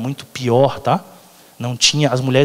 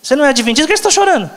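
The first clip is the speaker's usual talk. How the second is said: high, scolding mock voice; high, scolding mock of a judgmental churchgoer; 12 semitones higher, 3 dB louder, much faster than usual. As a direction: mimic a preachy critic, pitch way up. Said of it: "E tem gente que fala assim".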